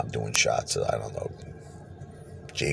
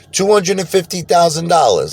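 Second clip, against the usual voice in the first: casino guy's voice; 6 dB louder than usual. Retold or imitated. Casino man names the jackpot.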